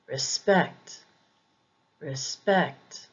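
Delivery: respect with SPECT stressed; second-syllable stress. Shown as a demonstration. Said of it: "is usually on the second syllable".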